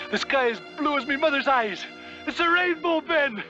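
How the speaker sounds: rising high with delight; excited wonder. Acted. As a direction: gasp it out in joyful awe. Sees the rainbow.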